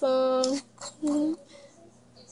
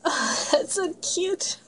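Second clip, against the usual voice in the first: high, slow cooing through giggles; giggly and charmed, pitch lifted; delighted laughing; 4 semitones higher, slower than usual. Real, not acted. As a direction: laugh as you gush over something adorable. Amused by the name Chunky Monkey.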